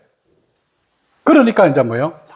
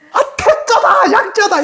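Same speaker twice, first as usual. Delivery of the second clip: acted exclamation of revelation; 10 semitones higher than usual. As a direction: exclaim it as a sudden discovery. Repeats friend's exclamation of revelation.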